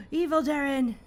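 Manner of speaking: high, loud excited cry; real excitement at the villain; excited exclamation. Real, not acted. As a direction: call out the name in thrilled surprise. Streamer reacts to villain reveal.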